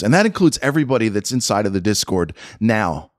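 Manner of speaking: ordinary delivery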